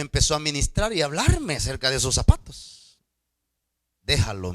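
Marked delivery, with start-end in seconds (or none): 4.0-4.5 lower, calm voice of God. Retold or imitated. Pastor quoting God to congregation, lower and grave. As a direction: lower to give God's command. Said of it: "me dijo Dios".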